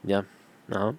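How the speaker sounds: mumbled, lazy, barely-there delivery; low-energy mumbling. Shown as a demonstration. Demoing for viewers how not to talk to camera, half-hearted mumble. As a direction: shrug it off like you can't be bothered. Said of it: "just be like".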